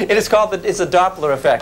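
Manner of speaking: normal talking voice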